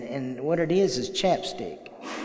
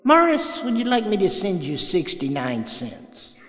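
higher teasing sponsor voice; mock the caller gently as his sponsor; 6 semitones higher, 3 dB louder than usual. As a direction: sponsor ribbing a friend over chapstick, higher. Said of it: "and I said / sponsor replies".

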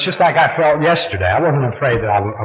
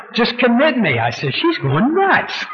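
laughing; 10 semitones higher, slower than usual. Retold or imitated. Mocking himself, laughing.

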